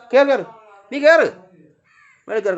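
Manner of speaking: loud, high repeated order; real shouted command; urgent command. Real, not acted. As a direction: push the cat to move, shouting. Urging a cat to climb.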